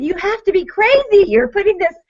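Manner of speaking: high disbelieving squeal; mock outrage at an ugly basket; shrill mock disbelief; incredulous. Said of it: "What, are you crazy?".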